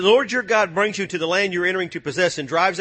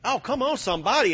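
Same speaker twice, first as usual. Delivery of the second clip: ordinary talk, nothing marked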